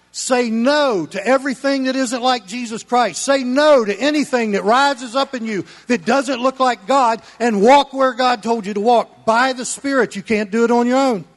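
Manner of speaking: loud, high, fired-up sermon delivery; full-force preaching. Acted. Pastor thundering at his church, loud preaching. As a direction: preach it hard to the congregation.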